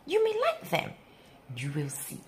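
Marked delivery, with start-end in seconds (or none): none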